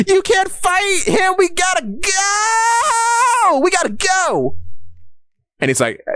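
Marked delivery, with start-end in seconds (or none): 0.1-4.6 very high, slow, hammed-up crying shout; mock wailing plea to run away; panicked Nami. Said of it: "And Nami's like".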